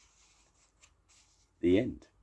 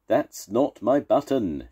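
slow, emphatic raised voice; storybook shout; 6 semitones higher, 5 dB louder, slower than usual. A narrator's reading. Toad rejecting Frog's button, shouting slowly and emphatically. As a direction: shout each word higher and louder. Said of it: "shouted Toad".

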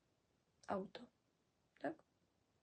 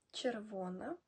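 normal talking voice

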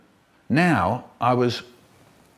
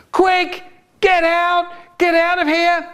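high, loud panicked shout; 3 dB louder than usual. Acted. Family shouts to get out in panic, high and loud. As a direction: yell to flee.

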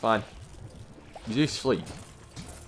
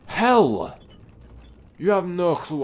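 high, loud exclamation; loud vented curse; exasperated outburst; 9 semitones higher, 7 dB louder, much slower than usual. Real, not acted. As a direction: let the frustration burst out in one word. Outburst after annoying struggle.

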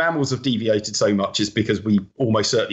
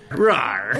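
dinosaur roar. Shown as a demonstration. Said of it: "You went full pirate".

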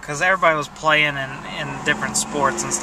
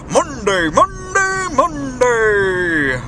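higher, stretched-out hype announcer shout; monster truck ad; 6 semitones higher, much slower than usual. Retold or imitated. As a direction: yell it like a TV rally commercial. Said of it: "announcer voice".

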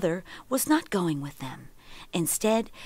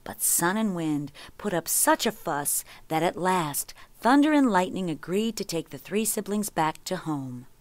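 plain reading voice

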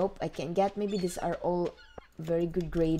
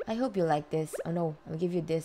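ordinary talk, nothing marked

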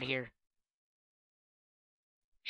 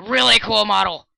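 excited; 6 semitones higher, 10 dB louder than usual. Real, not acted. Excited about bookshelf.

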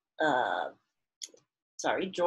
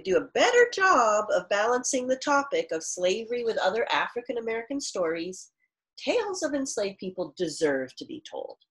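louder, clear read-aloud for the class; reading aloud to children; 4 semitones higher, 5 dB louder than usual. Acted. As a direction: read the author's words to students. Teacher reads author's note aloud.

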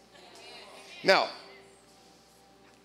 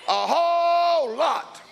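higher, louder, slow stretched shout; exultant shout; 10 semitones higher, 4 dB louder, much slower than usual. Real, not acted. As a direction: belt it out, slow and triumphant. Preacher shouting praise.

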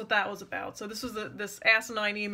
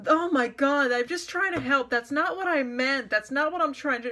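higher, hurried imitation of wounded innocence; mock defensive protest; 4 semitones higher, 3 dB louder than usual. Critic backpedalling after being told off, mock exasperated protest. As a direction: protest your good intentions, hammed up.

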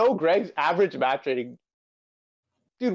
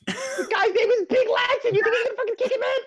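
high laughing yell; laughing shout. Real, not acted.

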